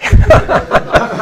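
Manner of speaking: a quick laugh with no voice behind it, going high; high breathless laugh. Real, not acted. Teacher laughing about [name]'s pet llamas, breathy and high. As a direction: laugh off the silly memory.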